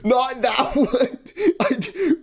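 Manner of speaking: laughing. Real, not acted. Laughing after wrong sound.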